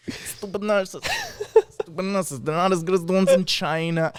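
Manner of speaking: put-on mobster-style voice; mafioso-like impression. Replaying the Trump bit, mafioso-sounding voice. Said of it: "sounded like a freaking mafia, like mafioso".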